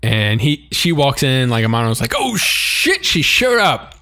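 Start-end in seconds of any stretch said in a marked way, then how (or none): 2.2-3.9 high-pitched mock shock at someone's arrival; alarmed reaction. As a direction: exclaim it in mock panic, pitched way up. Voicing Amano's panicked reaction.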